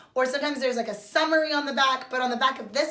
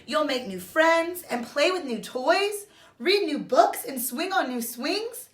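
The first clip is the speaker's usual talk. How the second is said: reassuring mother; 5 semitones higher than usual. Acted.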